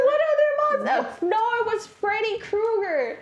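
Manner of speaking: very high loud outburst; a hysterical kid blurting it out; mock shrieking kid.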